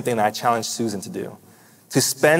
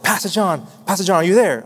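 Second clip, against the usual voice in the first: retold frightened call; 6 semitones higher than usual. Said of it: "frightened or so".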